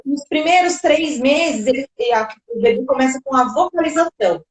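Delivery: usual speaking voice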